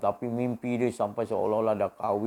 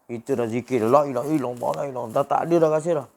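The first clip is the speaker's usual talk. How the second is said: ordinary talk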